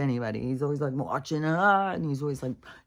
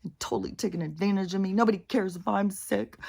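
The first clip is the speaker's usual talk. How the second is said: mock self-pitying whine, higher pitched; 6 semitones higher than usual. Dog walker grumbling to self that nobody cares, mock self-pity. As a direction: act out feeling mildly sorry for yourself. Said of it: "feeling sorry for myself".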